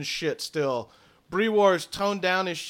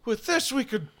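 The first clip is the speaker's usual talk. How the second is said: awed druid; 6 semitones higher than usual. Acted. Druids marvel at the relics.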